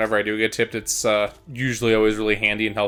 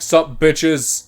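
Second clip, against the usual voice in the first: loud, higher cocky hello; cocky greeting; 5 semitones higher, 6 dB louder than usual. Retold or imitated. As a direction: swagger in like you own it. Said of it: "I'm like".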